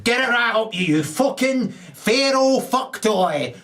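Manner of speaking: retold insult. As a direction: spit the retold insult back slowly. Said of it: "I said".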